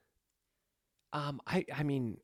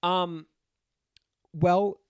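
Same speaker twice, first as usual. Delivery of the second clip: stuffy blocked nose from a cold; nose pinched; 3 semitones higher, 3 dB louder than usual. Real, not acted. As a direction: talk through a stuffed-up nose. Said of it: "sounds like I have a clothespin on my nose".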